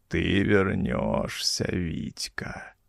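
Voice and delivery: put-on low, slow, creaky whisper of an ancient old man. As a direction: rasp it out like a sinister undead elder. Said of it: "раздался за спиной скрипучий голос деда Матвея".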